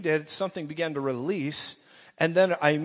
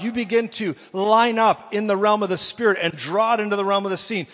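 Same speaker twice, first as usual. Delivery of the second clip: loud, driving preacher's cadence; full-force preaching; 4 semitones higher, 7 dB louder than usual. Acted. Preacher rousing the church, louder and louder. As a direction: pound each line louder at the congregation.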